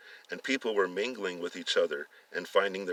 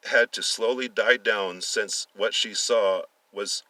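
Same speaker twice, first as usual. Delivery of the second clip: usual speaking voice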